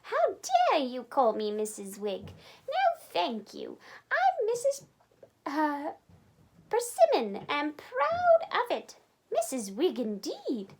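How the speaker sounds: high, indignant, offended voice; affronted housekeeper corrects her name, huffily; outraged landlady. A narrator's reading. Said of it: "She seemed to be upset".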